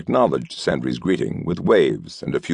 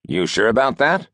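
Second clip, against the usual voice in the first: doubtful question; 6 semitones higher than usual. A narrator's reading. Regipisk doubtful.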